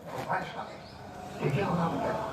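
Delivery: very soft, near-whispered reproof; barely voiced, reverent warning; hushed admonishment. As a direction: hush someone in a holy presence. Hushing someone before the deity.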